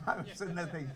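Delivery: can barely get it out for laughing. Real, not acted. Laughing at his own story.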